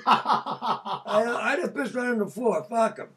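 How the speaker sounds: laughing through the words, high; giggling at his own prank. Real, not acted. Telling friends about pissing on the floor, laughing hard.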